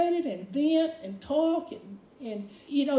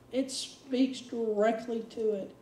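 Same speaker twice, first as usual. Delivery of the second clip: ordinary delivery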